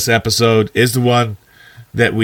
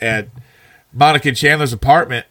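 ordinary talk

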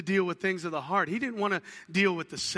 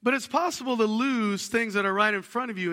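ordinary talk

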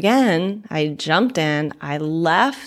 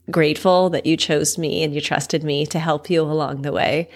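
normal talking voice

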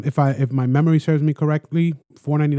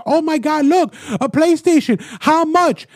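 eager buyer; 10 semitones higher than usual. Retold or imitated. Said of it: "another person and it says".

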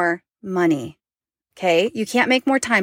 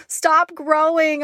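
pleading; 7 semitones higher, much slower than usual. Real, not acted. Emotional plea to daughter.

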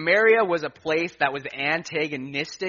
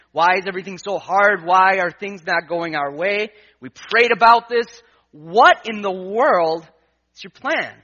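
desperate plea to God; 4 semitones higher, 6 dB louder than usual. Retold or imitated. Driving home, he pleads with God in rising, louder frustration. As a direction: cry out at God, slowing on "what in the world".

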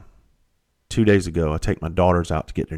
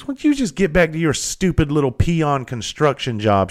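higher-pitched mock contempt; retold snooty insult; imitated scornful put-down; 7 semitones higher than usual. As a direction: act out a haughty woman belittling a worker. Retold woman insulting the worker.

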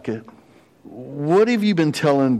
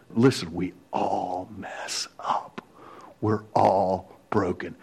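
slow, softer, choked-up whisper; tearful whisper; 4 semitones lower, 5 dB quieter, slower than usual. Real, not acted. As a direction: say it through real tears. Preacher moved near tears.

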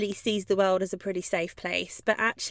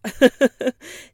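loud rising laugh; amused laugh; 4 semitones higher, 8 dB louder than usual. Real, not acted. Amused by her dog voices, loud real laugh.

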